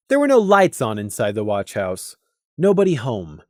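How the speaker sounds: plain reading voice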